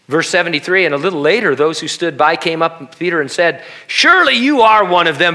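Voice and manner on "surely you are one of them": high, loud accusation; accusing shout. Acted. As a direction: call him out loudly. Bystanders accusing Peter.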